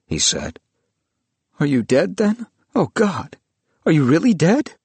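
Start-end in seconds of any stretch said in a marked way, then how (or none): 1.6-4.8 high, anguished questioning; distressed. Acted. Willis finds his old friend lifeless, distressed. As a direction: plead in growing alarm.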